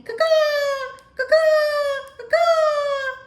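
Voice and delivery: loud, high cawing crow; crow caws. A narrator's reading.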